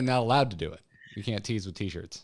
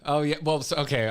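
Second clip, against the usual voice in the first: ordinary talk, nothing marked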